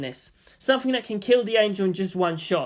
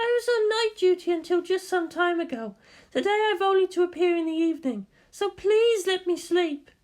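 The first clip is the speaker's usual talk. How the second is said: sleepy Misato; 13 semitones higher than usual. Acted. Misato pleads with Shinji to let her sleep, high drowsy voice. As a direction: beg to be left asleep.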